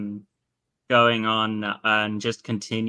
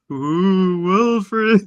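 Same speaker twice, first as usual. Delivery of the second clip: bad ghost impression, high and loud; spooky moaning ghost, hammed up; 9 semitones higher, 10 dB louder than usual. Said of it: "a really bad impression of a ghost".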